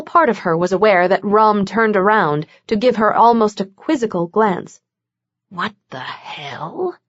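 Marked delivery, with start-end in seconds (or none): 5.5-7.0 low unvoiced mutter under breath. A narrator's reading. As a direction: mutter it in a whisper. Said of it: "he muttered".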